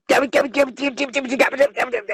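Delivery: squeaky mock voice, rapid get me; high mocking babble. Mocking the woman's chatter in a high squeaky voice.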